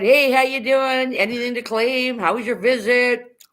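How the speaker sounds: a hammed-up cheery customs officer; cheery Canadian border agent. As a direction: greet like an overly friendly Canadian border guard. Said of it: "Get to the Canadian side".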